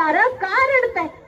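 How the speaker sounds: high, drawn-out 'why are you crying'; acted questioning. Passer-by asks the weeping crowd, high drawn-out questioning.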